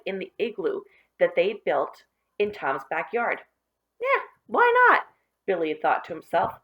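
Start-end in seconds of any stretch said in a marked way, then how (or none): 4.0-5.1 put-on high, bright boy's voice, much higher and louder; a cocky kid shrugging off a dare; bold boy. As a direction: toss it off like a daring kid.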